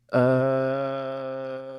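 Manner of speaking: "uh" held out on one level note; long drone. Shown as a demonstration. Podcast guest joking about his radio voice, long drone. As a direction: stretch the uh into a long monotone hum. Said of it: "long, long drones on podcast".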